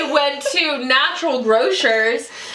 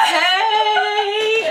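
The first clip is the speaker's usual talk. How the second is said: mock hey; 11 semitones higher than usual. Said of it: "I was like".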